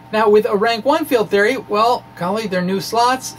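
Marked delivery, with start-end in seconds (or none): none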